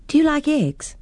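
put-on eager girl with an idea; hopeful little girl. Girl offers food.

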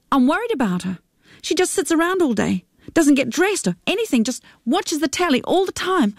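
pleading worried daughter, lightly voiced; lightly voiced Sarita. A narrator's reading. Narrator voices Sarita, higher.

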